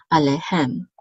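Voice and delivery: "alehem" stressed on the final "hem"; stress on last syllable. Shown as a demonstration. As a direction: let the weight fall on "hem" in "alehem". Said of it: "over the last syllable".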